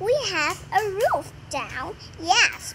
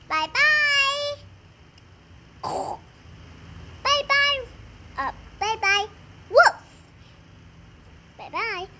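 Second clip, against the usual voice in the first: goodbyes; 7 semitones higher than usual. Acted. Family waving goodbye.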